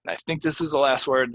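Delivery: ordinary talk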